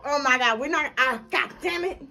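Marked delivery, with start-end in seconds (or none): none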